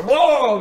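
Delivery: loud high whoa as he heaves; retching whoa. Acted. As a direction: heave it out behind the tree. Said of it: "push through the crowd and go".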